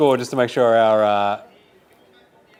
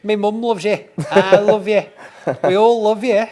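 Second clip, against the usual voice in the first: a put-on Manchester accent, pitched higher; 8 semitones higher, slower than usual. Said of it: "combination [name] and any member of Oasis accent".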